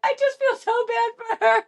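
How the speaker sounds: high, laughing mock sob; mock crying. Mocking Ashley's tearful pity, high teary whine. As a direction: fake a weepy whine through laughter. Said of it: "she's, like, crying".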